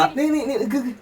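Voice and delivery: high laughing protest, stammering. Real, not acted. Husband laughing off wife's tearful complaint, high and amused. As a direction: laugh through the words while cutting her off.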